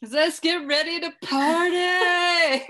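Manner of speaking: high, loud, drawn-out party cry; hammed-up movie quote, whooped slowly; Annie. Host quoting Bridesmaids to co-host, hammed-up party whoop. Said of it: "identify with Annie".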